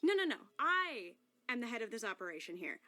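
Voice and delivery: usual speaking voice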